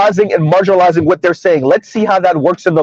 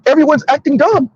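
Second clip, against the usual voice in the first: emphasis on "acting", pitched high; stress on acting; 7 semitones higher than usual. Real, not acted. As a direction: lean on the word "acting". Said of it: "Emphasis on the word acting".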